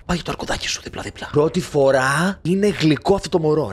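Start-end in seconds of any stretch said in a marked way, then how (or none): 0.1-1.3 soft whisper beside a sleeper; hushed whisper. Real, not acted. Player tucking bear beside sleeping baby, hushed whisper.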